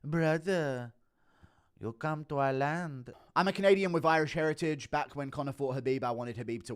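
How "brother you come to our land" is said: put-on slow, menacing Dagestani accent. Dagestani seeing you in a dark alley, slow accented threat. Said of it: "Impression of Dagestani seeing you in a dark alley".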